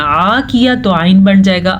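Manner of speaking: usual speaking voice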